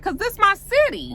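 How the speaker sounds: high, emphatic mock comeback; insistent retort. [name] defending himself to his wife, insistent. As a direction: fire back with the pitch way up.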